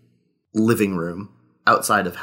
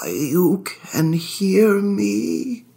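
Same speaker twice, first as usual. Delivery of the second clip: slow, higher wondering ghost voice; ghostly surprise; 10 semitones higher, much slower than usual. Acted. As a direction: marvel that someone finally hears. Ghost asks if heard.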